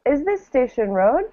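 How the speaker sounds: "is this Station Road?" lifting up at the end; rising question. Shown as a demonstration. Teacher prompting Amari with a rising question. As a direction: model the rise for Amari.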